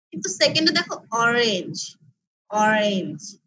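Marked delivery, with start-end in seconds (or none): 1.1-2.0 "orange" said as two beats, o-range; two syllables. Shown as a demonstration. Teacher introduces the second chart word, "orange" in two syllables. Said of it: "দুটো ভাগ করেছি আমি". 2.5-3.4 "orange" in two even, lower beats; two syllables. Shown as a demonstration. Teacher repeats the chart word, "orange" in two syllables. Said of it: "দুটো ভাগ করেছি আমি".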